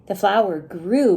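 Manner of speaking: usual speaking voice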